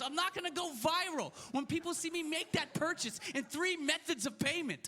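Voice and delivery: normal talking voice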